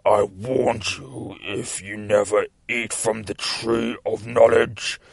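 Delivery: slow, rough, menacing demon. Retold or imitated. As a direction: intone the threat like a demon, drawn out. Demon speaks in retold comic.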